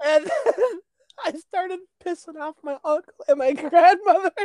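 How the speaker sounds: high, laughing through the words; telling a funny sleep story while laughing; laughing hard. Real, not acted. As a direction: talk while cracking up.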